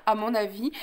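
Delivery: ordinary delivery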